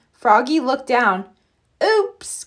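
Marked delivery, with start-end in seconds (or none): 1.8-2.4 high, surprised oops; startled little oops. A narrator's reading. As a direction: caught out, pitch jumps up. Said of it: "cried Froggy".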